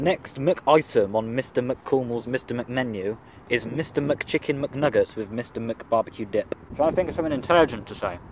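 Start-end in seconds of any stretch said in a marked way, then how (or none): 0.0-6.5 slow, mock-grand menu announcement; lightly hammed-up menu read; mock announcer. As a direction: announce it like a silly game show.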